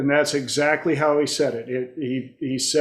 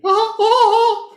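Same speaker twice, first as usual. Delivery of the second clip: very high, loud cartoon chuckle; squeaky falsetto mouse laugh; Mickey Mouse chuckle; 7 dB louder than usual. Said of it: "using the voice of Mickey Mouse".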